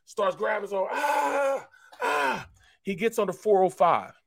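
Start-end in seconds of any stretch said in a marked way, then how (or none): 0.9-2.5 high, drawn-out imitated yell; psych-up yell. Angry lifter psyching up, imitated high yell. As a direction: let out long high cries of effort. Imitated roar while grabbing his arm.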